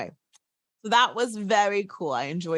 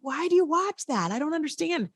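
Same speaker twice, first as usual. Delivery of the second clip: higher-pitched imitation of puzzled questioning; mock bafflement; 8 semitones higher than usual. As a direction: ask it in exaggerated confusion.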